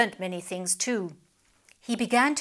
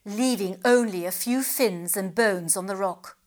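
plain reading voice

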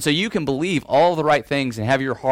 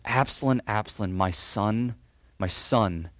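soft, slow lament for a lost son; grieving father; 4 semitones lower, 6 dB quieter than usual. Retold or imitated. David mourning his son Absalom, softly grieving. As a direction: let the name fall away in grief.

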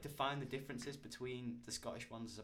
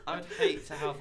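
squeaky voice breaking into laughter, much higher and louder; laughing too hard to say it straight; 7 dB louder than usual. Real, not acted. Still laughing at the Big Brother joke, squeaky.

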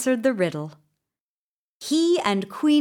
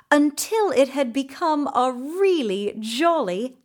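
ordinary delivery

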